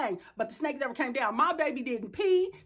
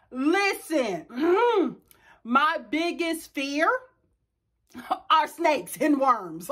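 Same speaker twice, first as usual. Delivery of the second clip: usual speaking voice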